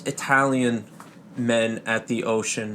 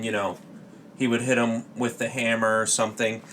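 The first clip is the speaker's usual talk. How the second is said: ordinary delivery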